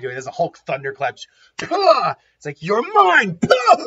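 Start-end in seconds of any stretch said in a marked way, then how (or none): 1.6-3.8 high, loud mimicked blasts; impact sound effects. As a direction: spit out the pah like a thunderclap.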